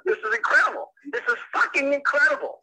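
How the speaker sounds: high-pitched, exasperated disbelief; incredulous outrage. Real, not acted. Husband hearing a stranger's claim about his wife, incredulous outrage. Husband stunned by prank caller's news.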